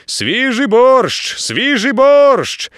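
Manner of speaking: loud, slow, high hawker's call; announcing call. A narrator's reading. Navigator proudly announcing fresh borscht, loud slow call. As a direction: cry it out like a street vendor. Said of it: "дуже вчасно сповістив штурман".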